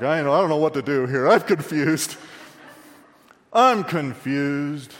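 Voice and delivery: hammed-up helpless confusion; mock confusion. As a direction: play the baffled man, drawing out the last words. Acting out bewildered men in congregation.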